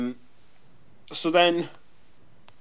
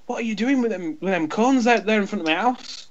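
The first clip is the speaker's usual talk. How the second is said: higher, indignant mock neighbour voice; mimicking the neighbour; 6 semitones higher than usual. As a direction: play the put-out homeowner, pitched up. Neighbour quoted in retold row.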